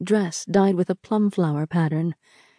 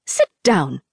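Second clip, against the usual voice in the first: stern order. A narrator's reading. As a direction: command it with a sharp bite. Auntie Fang orders Rin.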